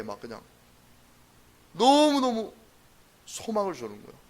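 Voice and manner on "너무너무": 너무너무 high and loud; a preacher overwhelmed with hope; exultant emphasis. Real, not acted. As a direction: burst out on 너무너무. Joy in verse.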